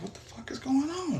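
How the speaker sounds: very high, soft, baffled; high startled whisper-cry. Retold or imitated. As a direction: squeak it out in shock. Confused after bullet hits.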